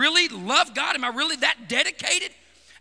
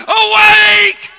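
much louder, held ringing shouts; climactic cry; 8 semitones higher, 18 dB louder, much slower than usual. Real, not acted. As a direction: roar the word to wake the church.

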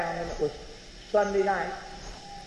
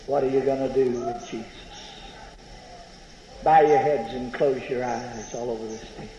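altar call; 4 semitones lower than usual. Acted. Preacher inviting the tent congregation to pray, hushed altar call. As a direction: call the tent to bow heads.

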